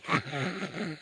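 soft wicked chuckle; evil laugh. Acted. Wolf chuckles wickedly before swallowing the girl. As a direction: laugh like a wolf about to pounce.

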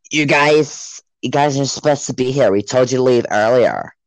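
lightly put-on scolding wife. Wife telling teens to leave the road, mock scolding. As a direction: imitate a fussy complaining neighbour. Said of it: "Why are you making her British".

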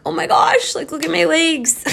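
mock gasp of alarm at a poison oak rash; alarmed surprise. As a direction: retell the shock of seeing the rash on her legs. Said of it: "And I was like".

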